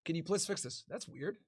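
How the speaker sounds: normal talking voice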